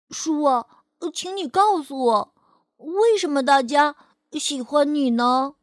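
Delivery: lightly voiced little boy, earnest and curious; a small boy politely questioning a book; child Fei'er voice. Fei'er asking the book in an eager child voice.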